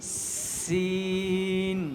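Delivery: slow firm সিন demo; drawn-out sin. Shown as a demonstration. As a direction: hold the hiss of সিন long. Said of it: "এটাও শক্ত করে উচ্চারণ করতে হবে".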